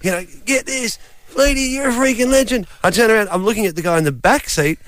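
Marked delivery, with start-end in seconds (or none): 0.4-2.7 mock a fan calling from a car; half-voiced retold yell; imitated fan's yell. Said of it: "so the driver's gone".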